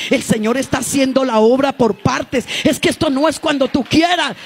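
high, loud, slow scolding shout; retold shout. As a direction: thunder the warning at her. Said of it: "yo le dije". (le grita alto y despacio)

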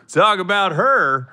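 ordinary delivery

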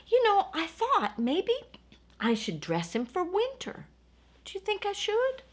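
ordinary delivery

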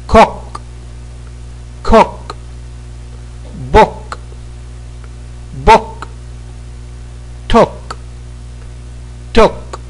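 crisp classroom drill; pronunciation drill. Shown as a demonstration. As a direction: say each word like a teacher for the class to repeat. Short u drill.

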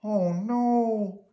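high, wailing "oh no"; cartoonish puppet dismay; dismayed cry. A narrator's reading. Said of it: "cried Bert".

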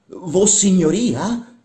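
high, loud, drawn-out disbelief; astonished question. Acted. Workman to his master, astonished. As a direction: stretch it out, rising in amazement.

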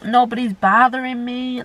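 usual speaking voice

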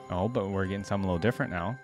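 ordinary delivery

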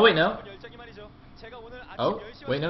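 usual speaking voice

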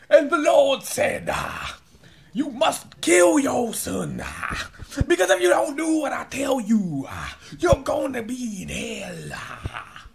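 loud, high preacher shout with drawn-out "ah" endings; TV evangelist preaching. Retold or imitated. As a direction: bellow it like a fire-and-brimstone televangelist. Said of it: "a demonstration of what he was saying".

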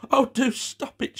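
high, distressed plea; begging. A narrator's reading. Gwen, sick and covering her ears, begs desperately. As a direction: beg loudly for it to end. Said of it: "she begged".

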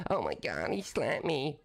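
put-on distressed victim voice, swooping in pitch; mock victim whine. Streamer mocking a troll who'd run to police, whiny victim voice. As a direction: imitate a wimp complaining about a slap.

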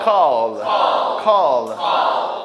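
"call" drilled loud as open kaw-l; open English vowel. Shown as a demonstration. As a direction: drop the jaw on "call". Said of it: "Call 하지 말고".

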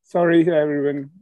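usual speaking voice

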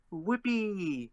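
high mock cheer; stock game voice. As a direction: imitate a canned game celebration. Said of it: "like, a stock, uh, voice".

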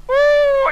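loud, very high hoot; sudden high "ooh" whoop. Retold or imitated. Preacher acting out the miracle moment, high whoop. Imitates sudden sensation as hands descend.

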